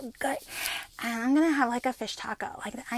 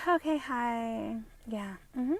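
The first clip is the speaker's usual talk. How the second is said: subdued, dragged-out patient voice; mock slow speech; 5 dB quieter than usual. Answering a psychiatrist as others do, mockingly slow. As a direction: imitate a slow talker with soft, halting replies. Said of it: "that's fucking slow as fuck".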